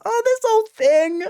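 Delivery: high-pitched, put-on scoffing brush-off; mock scoff. Imitating the actress's costume-reveal interjection, high mock scoff. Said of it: "scoffs it up and just goes".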